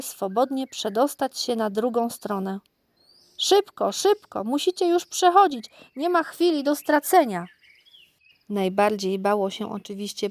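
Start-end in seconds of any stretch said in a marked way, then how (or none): 3.4-7.5 high, loud urging; urgent hurrying. A narrator's reading. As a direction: hurry them across, no time to lose. Urging animals across the bridge.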